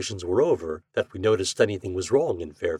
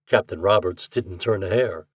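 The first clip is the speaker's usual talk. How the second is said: ordinary delivery, nothing marked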